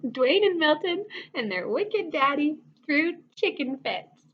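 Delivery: ordinary delivery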